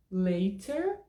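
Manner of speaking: LATER with a crisp T and sounded final R; hard T with R. Shown as a demonstration. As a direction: hit the T in LATER, keep the R. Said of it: "cinco opções".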